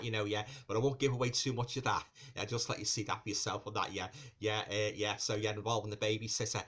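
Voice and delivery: ordinary talk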